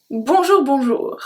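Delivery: cheerful, bouncy bonjour, bonjour; happy dad bonjour. Shown as a demonstration. Teacher contrasts the happy dad greeting, cheerful. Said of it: "the happy one".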